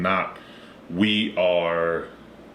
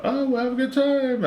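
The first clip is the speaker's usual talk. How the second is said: high, slow, prissy brush-off; mock prissy farewell. New Yorkers dismissing them, high slow prissy imitation.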